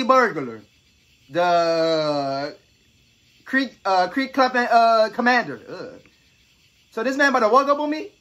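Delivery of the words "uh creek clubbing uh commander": ordinary talk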